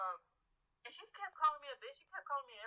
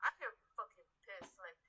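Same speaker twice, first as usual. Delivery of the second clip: normal talking voice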